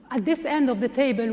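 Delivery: ordinary talk, nothing marked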